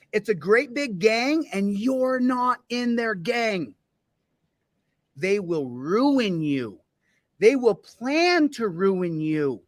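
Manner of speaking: very slow, drawn-out menace; slow ominous warning. Acted. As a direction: warn them slowly and darkly.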